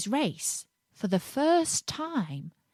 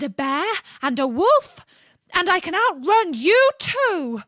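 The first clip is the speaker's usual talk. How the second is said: much higher, much louder screamed boast; screaming; 11 semitones higher, 8 dB louder than usual. A narrator's reading. Gingerbread man leaning close and screaming at the fox. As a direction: scream it right into his ear. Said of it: "screamed out".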